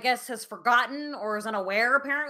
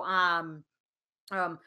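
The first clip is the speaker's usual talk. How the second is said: ordinary talk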